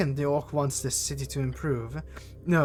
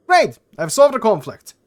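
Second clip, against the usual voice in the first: loud high burst of joy; excited; 6 semitones higher, 10 dB louder than usual. Real, not acted. Gamer celebrating a solved quest, excited shout. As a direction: blurt it out, thrilled.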